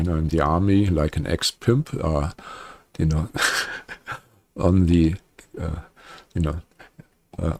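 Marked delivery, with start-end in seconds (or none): 3.3-4.2 soft, voiceless chuckle; short breathy laugh to himself; quiet amused chuckle mid-story. Real, not acted. Amused at own army story.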